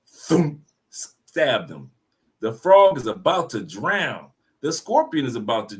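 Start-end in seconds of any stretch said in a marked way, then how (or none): none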